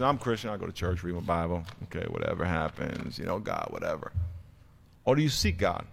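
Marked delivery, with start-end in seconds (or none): 0.0-4.1 soft, dismissive shrug of a voice; indifferent churchgoer. Retold or imitated. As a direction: sound like you can't be bothered. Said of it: "go through the motions".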